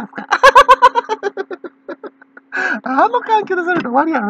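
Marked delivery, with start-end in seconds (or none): 0.3-2.1 a high, ringing laugh; real amused laughter. Real, not acted. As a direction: let a big laugh out. Laughing at own story.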